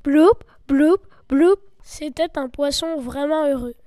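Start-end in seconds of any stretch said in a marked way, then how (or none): 0.0-1.6 loud rising bloups; three loud popping bloups; bubble sounds. Acted. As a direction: pop out happy bubbles. Repeated fish bubbles.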